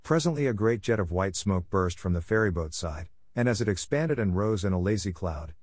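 plain reading voice

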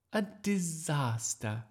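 high, slow lament; sighing. A narrator's reading. Miss Darling after the ruined play, sighing dismay. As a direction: sigh it out with drawn-out dismay. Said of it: "sighed Miss Darling".

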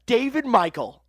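loud, high full-name call; mock scolding. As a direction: call out like telling off a naughty child.

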